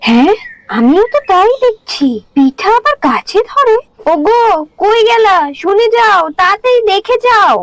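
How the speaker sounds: high, excited calls to her husband; amazed wife. Acted. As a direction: marvel in a high voice, urging him to come. (বিস্ময়ে উত্তেজিত গলায় স্বামীকে ডাকা)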